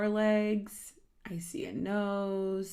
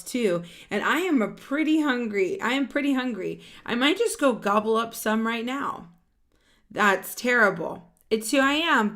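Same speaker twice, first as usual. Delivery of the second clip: louder, lively read-aloud of a character line; animated storybook voice; 4 semitones higher, 7 dB louder than usual. Acted. Reading a picture book to children, animated and louder. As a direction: voice the characters brightly for the kids.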